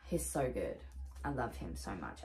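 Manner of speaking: soft, low, tender coo over a toy. Real, not acted. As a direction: murmur lovingly to the plush. Cooing over a plush toy.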